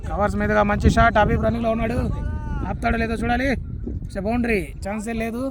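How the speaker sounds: lively live play-by-play; cricket commentary. Real, not acted. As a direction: call the match as it happens.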